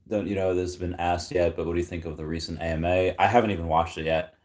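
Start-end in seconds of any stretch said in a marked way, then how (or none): none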